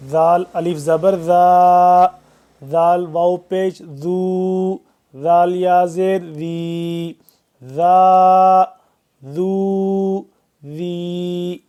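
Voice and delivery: زا with the tongue tip between the teeth; soft ذ sound. Shown as a demonstration. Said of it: "زبان کی نوک کو دو دانتوں کے درمیان".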